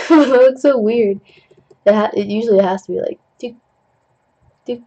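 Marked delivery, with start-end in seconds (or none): none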